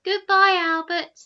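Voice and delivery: loud, high fake-casual goodbye; casual call. Eldest boy calling over his shoulder in a casual voice. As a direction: call it out, pretending not to care. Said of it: "in a casual voice".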